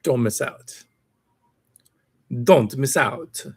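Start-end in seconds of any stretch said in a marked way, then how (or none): 2.4-3.5 slow, higher put-on sales voice; mock ad pitch. Streamer repeating the article's slogan, slow mock sales pitch.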